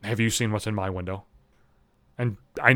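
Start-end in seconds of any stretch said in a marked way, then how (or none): 0.0-1.2 low, soft mock-mysterious question; understated, leading question; neighbor woman. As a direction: lightly voice a coy neighbor, hushed. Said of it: "She's like".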